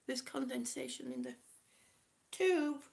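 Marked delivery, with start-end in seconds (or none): none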